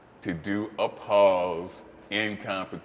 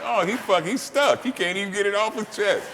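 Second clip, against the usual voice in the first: much higher, alarmed retold gasp; shocked disbelief; 9 semitones higher than usual. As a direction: panic that the lifter is stuck. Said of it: "I'm like".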